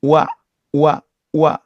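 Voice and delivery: 와 said as oo then a; split wa. Shown as a demonstration. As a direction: glide 와 from oo. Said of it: "와가 한꺼번에 안 되는 거죠".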